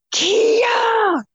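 sister yelling a name from the top of the stairs; shouted name. Retold or imitated.

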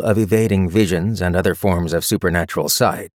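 ordinary delivery

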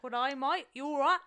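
loud, hammed-up Aussie greeting; jokey Aussie, rising at the end; Australian accent. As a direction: put on a broad mock Australian accent. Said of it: "I think, is Australian".

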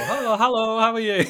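overly bright fake pleasantries; mock cheery greeting. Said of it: "and then we go".

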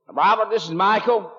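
loud, high mock American accent; hammed-up American accent on the phone; imitated American accent. Scottish friend phoning from America, imitated American accent. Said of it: "Why are you speaking like that?".

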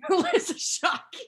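high, loud laughing repeat. Real, not acted. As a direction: laugh the name out.

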